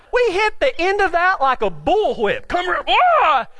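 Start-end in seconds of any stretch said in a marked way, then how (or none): 2.5-3.5 higher yelp breaking into "ah"; mock cry. Rider hitting the end of the rope, mock cry. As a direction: act out a sudden violent jolt. Acting out being yanked by horse.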